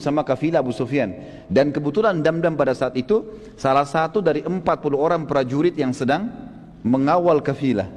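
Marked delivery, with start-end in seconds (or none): none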